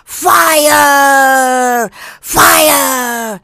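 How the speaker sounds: loud, high, drawn-out shout; full-lunged warning shout; top-of-the-lungs yell. Shown as a demonstration. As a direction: yell it out like a real alarm. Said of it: "Yell at the top of your frickin' lungs".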